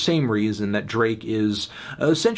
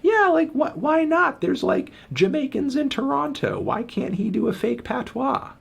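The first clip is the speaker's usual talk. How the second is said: very high-pitched mock fan voice; mocking excuse-makers. Critic mimicking fans who defend the rapper, mocking high voice. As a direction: squeak out feeble excuses in falsetto. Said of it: "falling over themselves to be like".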